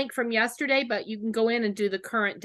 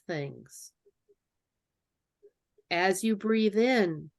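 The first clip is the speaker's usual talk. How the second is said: slow, lower, calm meditation voice; guided meditation; 3 semitones lower, slower than usual. Acted. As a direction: ease them into calm, slowly. Leads a positive meditation.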